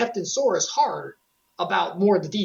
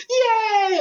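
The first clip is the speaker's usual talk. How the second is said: put-on high, loud childish yay; excited cheer; 12 semitones higher, 5 dB louder than usual. Sora cheering after the hug, excited. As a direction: squeal it like a happy kid. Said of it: "He's like".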